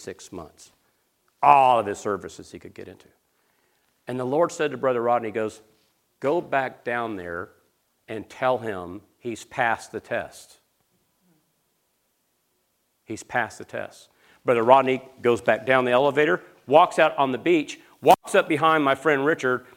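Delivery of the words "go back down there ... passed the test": soft, slow, lowered voice with weighty pauses; solemn quiet command. Retold or imitated. Preacher voicing the Lord's word to a minister, hushed and solemn. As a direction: speak it slowly and softly, letting it settle. The Lord speaking, acted in story.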